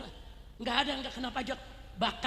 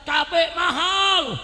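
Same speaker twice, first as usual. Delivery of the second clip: mock protest cry, higher and louder; imitated women's shout; 6 semitones higher, 10 dB louder, much slower than usual. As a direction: stretch it out like a complaining crowd. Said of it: "Ibu-ibu teriak lagi".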